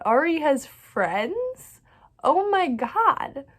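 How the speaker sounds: excited disbelief. Real, not acted. Gushing over new characters.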